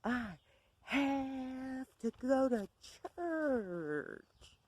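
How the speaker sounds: high, slow, drawn-out mock scream; imitated scream. Bev screaming into the phone about church, slow mock scream. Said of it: "she screams into the phone".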